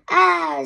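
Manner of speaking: sharp loud yelp of pain. A narrator's reading. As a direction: yelp as if kicked. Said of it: "yelped Perfect Peter".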